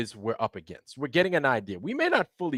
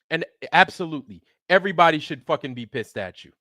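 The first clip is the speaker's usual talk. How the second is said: getting mad; 4 semitones higher than usual. Real, not acted. Ranting at the liar with no credentials, real anger. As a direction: let the anger boil over at him. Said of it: "Now I'm getting mad".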